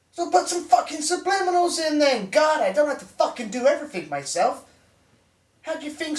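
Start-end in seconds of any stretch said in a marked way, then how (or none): 0.1-4.6 high, exasperated rant; fed-up, raised-pitch tirade; exasperated order. Acted. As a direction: give orders as if surrounded by idiots. Angrily ordering someone on the phone.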